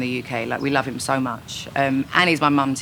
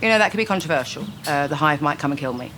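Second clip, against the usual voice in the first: ordinary talk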